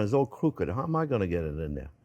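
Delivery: ordinary talk, nothing marked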